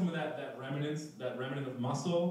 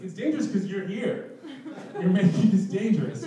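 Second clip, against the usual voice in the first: exasperated retort; 5 semitones higher, 7 dB louder than usual. Retold or imitated. Arguing back with the guide.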